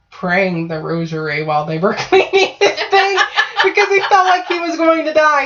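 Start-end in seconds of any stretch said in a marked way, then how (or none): 2.0-5.4 high voice breaking up with laughter; laughing. Real, not acted. Storyteller cracking up over cousin's rosary prayers, laughing. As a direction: struggle to tell it through real laughter.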